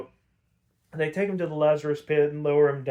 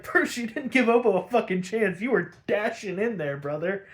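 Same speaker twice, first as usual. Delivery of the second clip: mock-protesting, pitched up; incredulous objection; 6 semitones higher than usual. Viewer arguing with Bruce about Obo's chances, incredulous. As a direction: call him out in a higher, disbelieving voice.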